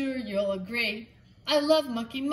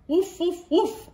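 higher playful woofs; dog woofs; 6 semitones higher, 5 dB louder than usual. Acted. Dog barking to the sheep, higher and playful. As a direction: bark like a friendly dog.

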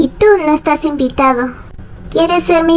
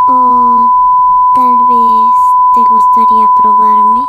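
pitched way up and louder, teasing come-on; seductive tempter; 3 dB louder than usual. Acted. Ice cream tempting hungry Peter, very high loud seductive voice. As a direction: lure him in, high and loud.

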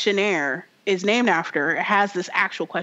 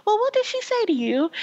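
high mock British question; British accent; 12 semitones higher than usual. Game hero asking what the ex whispered, mock British. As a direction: ask in a put-on, high-pitched British voice. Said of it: "that's my British accent".

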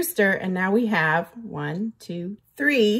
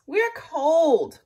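higher, whiny complaint; complaining turtles; 6 semitones higher than usual. A narrator's reading. Turtles complain to the rooster, higher whiny voice. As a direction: grumble about the cold. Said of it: "complained the turtles".

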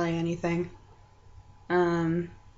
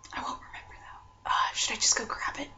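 whispering; 4 semitones higher, 10 dB quieter than usual. Real, not acted. Quiet aside to herself.

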